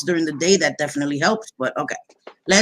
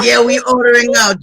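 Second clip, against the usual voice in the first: louder, a bit higher, offhand husband voice; a hint of a blunt husband, hammed up; mock husband; 3 semitones higher, 7 dB louder than usual. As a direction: lightly play the husband deciding on takeout. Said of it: "He was like".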